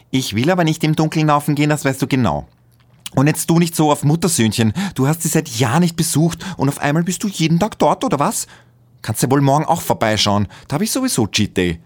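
spoiled partner mocking him; whiny girlfriend. A narrator's reading. Magdalena whines and mocks him. (quengelnd spöttisch, viel höher und lauter)

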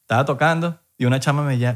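ordinary talk, nothing marked

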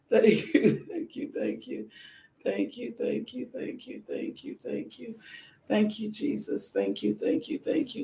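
soft, murmured thanksgiving prayer, repeating thank you; whispered prayer. Real, not acted. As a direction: pray quietly and fervently under the breath.

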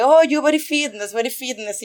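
put-on broken English with a Soviet accent, loud on oh; mock Russian English. Big Belarusian guy at the hot springs flirting, mock Russian accent. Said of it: "Y me dice así como que".

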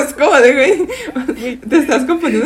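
high, loud laughing words; cracking up mid-sentence. Real, not acted. Laughing at friend's wrong impression.

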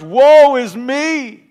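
loud, slow anguished cry; loud slow cry of woe. Acted. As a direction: cry out in despair. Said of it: "he cries out these words".

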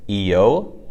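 "E-yo" as double E then yo; pinyin IU. Shown as a demonstration. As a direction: say "E-yo" joined. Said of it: "double E and then yo".

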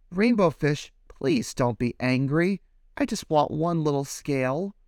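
lightly voiced small fish asking; little fish pleading. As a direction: beg sweetly for a scale.

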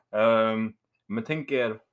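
ordinary talk

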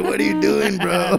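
high, loud disbelieving outcry; incredulous friends. Retold or imitated. Friends to peeing Hector, high loud disbelief. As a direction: sound stunned by your buddy. Friends seeing him pee.

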